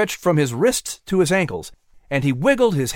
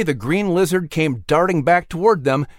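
ordinary delivery, nothing marked

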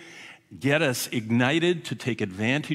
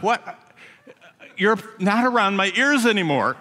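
surprised protest; 7 semitones higher, 4 dB louder than usual. Retold or imitated. Alarmed thought during haircut.

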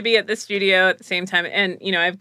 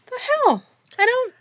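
much higher baffled "the hell". Retold or imitated. Aaron reacting to Eric's hair insult, baffled and high. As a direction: caught off guard by an insult.

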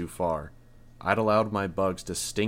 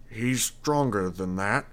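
unsure father; 3 semitones higher, slower than usual. A narrator's reading. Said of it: "He didn't sound sure".

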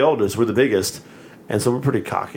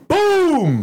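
loud, high mock crash boom; sudden mock impact noise; imitated boom; 4 dB louder than usual. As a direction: bang it out like a hit. Imitating the bang on truck.

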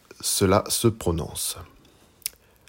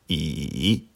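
I dipping low then climbing back up; third tone on I; 4 semitones lower than usual. Shown as a demonstration. Teacher showing learners Mandarin I, dipping tone. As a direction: draw I down and up again. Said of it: "Une dernière fois".

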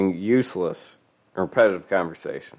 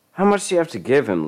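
fast, urgent pleading; 7 semitones higher, faster than usual. Acted. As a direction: plead quickly. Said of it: "Lisa pleaded".

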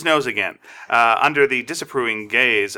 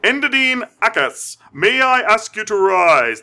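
event presenter; 5 semitones higher than usual. Acted. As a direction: introduce the guest of honor with ceremony. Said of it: "I'll be the presenter".

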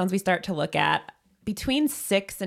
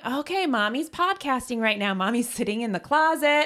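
lightly raised parent narrating; self-talk demo; 7 semitones higher, 4 dB louder than usual. Shown as a demonstration. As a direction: narrate your actions to a toddler. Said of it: "the self-talk is you talk about what you're doing".

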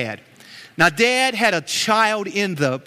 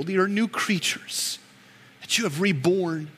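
prayer; 2 semitones lower, 3 dB quieter than usual. Acted. Pastor praying over the hurting congregation, soft and earnest. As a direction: pray it quietly over the church.

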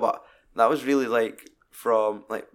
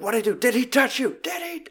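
acted-out angry father, high-pitched; rising, high-pitched mock fury; angry interrogation; 13 semitones higher than usual. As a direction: imitate a frantic, angry demand. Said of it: "he's that fucking angry".

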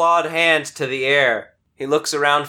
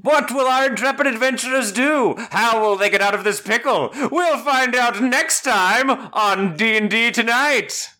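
cliffhanger announcer; 8 semitones higher than usual. Acted. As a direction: tease the next episode. Cliffhanger show outro.

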